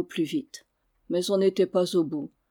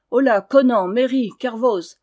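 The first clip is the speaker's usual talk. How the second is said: loud shout, higher, across the churchyard; shouted call; 4 semitones higher, 8 dB louder than usual. Acted. Knight shouts to his archers in the cemetery, loud. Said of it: "cria le chevalier".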